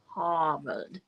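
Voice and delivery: Harvard with a Boston accent, the r dropped, Hahvahd. Shown as a demonstration. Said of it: "that's my best Bostonian accent".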